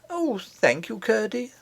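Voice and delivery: a little princess thanking her rescuer, relieved; grateful princess. Acted. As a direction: thank him through the last tears.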